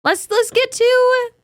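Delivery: very high, drawn-out host call; mock-grand segment intro. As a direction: stretch it out slowly, way up high. Host hypes the next segment.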